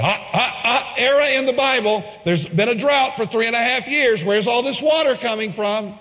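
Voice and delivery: high, laughing scoffing voice; mocking skeptics. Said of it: "people who question the Bible said".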